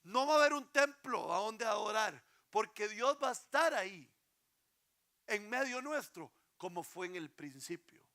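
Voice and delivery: loud, high, ringing sermon delivery; impassioned preacher's call; full-force preaching. Acted. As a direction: proclaim it to the whole congregation. Preaching opening.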